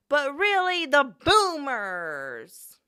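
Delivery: loud, very slow, high wail; mock rant. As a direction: drag out the boomers in despair. Rant climax.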